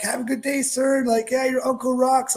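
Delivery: breezy official waving him through; friendly guard voice. Retold or imitated. Guards waving nervous kid through.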